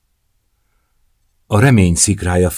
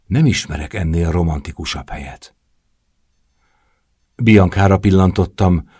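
usual speaking voice

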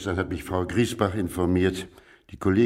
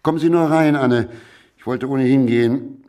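calling out; 4 semitones higher, 8 dB louder than usual. Acted. Calling Anne into the room.

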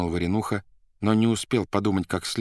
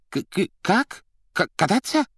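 high, stuttering disbelief; stammering shock; 12 semitones higher, 4 dB louder than usual. A narrator's reading. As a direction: stammer in confusion.

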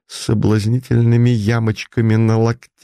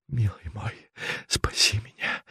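seductive whisper; 12 dB quieter than usual. A narrator's reading. Princess seduces banker.

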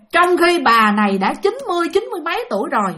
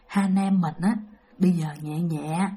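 low, soft murmur; quiet plotting; 7 semitones lower, 7 dB quieter than usual. Retold or imitated. Brother quietly tells his sister they'll sneak out: soft, low murmur. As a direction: keep it barely audible, like sneaking.

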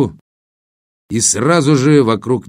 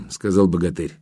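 ordinary delivery, nothing marked

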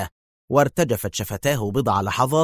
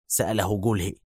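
ordinary delivery

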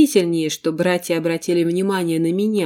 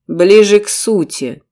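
louder, impatient bark; 7 dB louder than usual. Acted. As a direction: snap it out to cut her short. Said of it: "громко рявкнул Рейстар".

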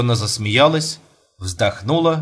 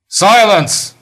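commanding; 9 semitones higher, 7 dB louder than usual. Acted. Husband silences the table.

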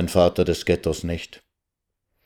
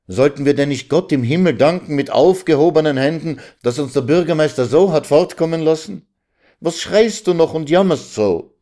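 lightly voiced, higher-pitched pleading husband; mildly reproachful questions to his wife; exasperated husband; 8 semitones higher than usual. (leicht vorwurfsvoll fragender Ehemann)

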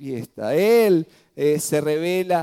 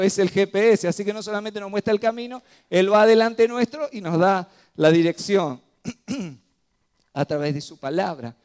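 usual speaking voice

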